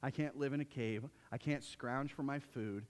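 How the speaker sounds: low, soft, defeated sigh of a voice; weary despair. Retold or imitated. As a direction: sound worn out and ready to quit. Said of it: "Most of us would be like".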